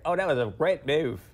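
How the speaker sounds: put-on British accent. Cohost plays a British dance judge praising a move, shaky British accent. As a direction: attempt a British guy, slipping towards Australian. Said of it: "the British guy".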